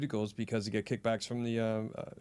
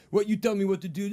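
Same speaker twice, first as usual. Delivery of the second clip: higher-pitched mock indignant challenge; retold angry yell; 6 semitones higher, 4 dB louder than usual. Man challenged over motorcycle parking, retold angry yell. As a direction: imitate a man squaring up for an argument. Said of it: "we kinda yell into each other".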